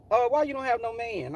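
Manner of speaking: high, campy nosy question; extra diva act. Retold or imitated. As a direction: mimic the queen prying. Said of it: "that's just what he was".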